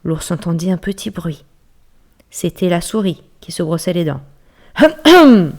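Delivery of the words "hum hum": high, loud hum hum to call a friend to order; pointed throat clearing. Acted. Bear clearing his throat at the noisy mouse, high and loud. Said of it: "pour rappeler discrètement son ami à l'ordre".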